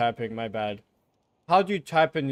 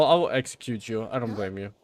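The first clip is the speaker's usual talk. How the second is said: ordinary delivery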